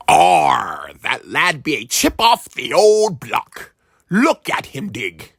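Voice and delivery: gruff pirate brogue; an old pirate proud of the boy. A narrator's reading. Pirate ancestor watching the boy dig, pirate voice.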